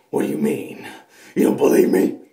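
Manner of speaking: loud, hissed demon version of Snake; whisper-shout it like a possessed soldier; a demon bursting out of Snake; demonic Snake. Acted. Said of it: "That was kinda demonic, wasn't it?".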